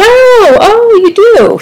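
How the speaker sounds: high mock surprise; surprised. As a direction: fake surprise, high and bright. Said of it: "she said".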